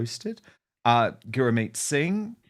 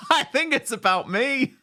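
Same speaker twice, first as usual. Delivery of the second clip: laughing; 13 semitones higher than usual. Real, not acted. Joking guess between laughs.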